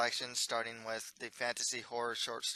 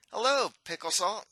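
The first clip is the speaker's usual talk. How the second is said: loud, higher call across the forest; prince yelling hello; 8 semitones higher, 7 dB louder than usual. Acted. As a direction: call out to a friend at a distance. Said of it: "The Mustard Prince yells at him".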